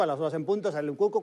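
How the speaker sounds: usual speaking voice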